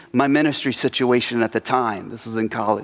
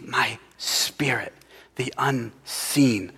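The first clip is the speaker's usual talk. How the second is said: slow unvoiced whisper, much softer; 5 dB quieter, much slower than usual. Shown as a demonstration. Preacher quoting Zechariah, whispered slowly. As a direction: breathe the words out slowly. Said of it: "you see".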